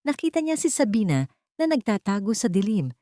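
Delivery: ordinary delivery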